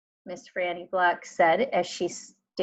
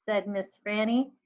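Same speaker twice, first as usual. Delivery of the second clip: usual speaking voice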